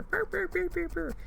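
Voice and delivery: high mock bark-talk; imitated back-barking. Imitating the dog barking back at her, high yaps. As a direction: yap back like a sassy teen dog. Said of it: "like a fucking teenager".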